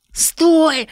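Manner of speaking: high, loud squealing cry; shrill shout. Acted. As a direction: shriek it to stop him. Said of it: "крикнул Егор противным визгливым голосом".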